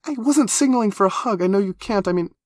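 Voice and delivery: put-on very high, loud pouting girl; high whiny complaint. A narrator's reading. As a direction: whine it out, wounded. Embarrassed backpedal.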